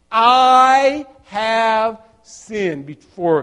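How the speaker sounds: very slow, weighty I have sinned; slow, solemn admission; slow confession. Acted. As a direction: draw out each word of the confession. Son rehearsing confession.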